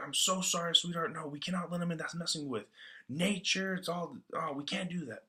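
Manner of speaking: high-pitched, imploring husband; hammed-up desperate plea; acted urgent pleading. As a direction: beg them to stop, voice pushed well up. Husband begs wife not to open.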